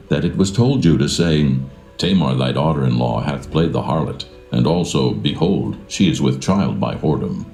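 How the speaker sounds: normal talking voice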